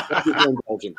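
laughing through the thanks; real laugh, then thanks. Real, not acted.

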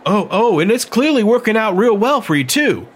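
mock his so-called system; Emmeline scorning Meade's betting system, disgusted; disgusted sarcasm. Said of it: "Emmeline said with a disgusted look".

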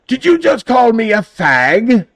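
high, loud, slow incredulous shout; hammed-up shocked indignation; mock outrage. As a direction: imitate someone scandalised, drawn out and high. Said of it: "turn red as an apple".

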